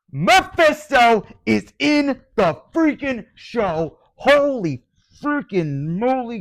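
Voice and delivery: ecstatic high-pitched yell, very loud; real fan freak-out at full volume; excited yelling. Real, not acted. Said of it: "I'm now yelling".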